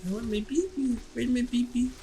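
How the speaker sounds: put-on high, soft whispered pleading for the baby; mock ghostly wail. Still mocking the boss, in a thin ghostly plea. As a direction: croon it like a haunted mother.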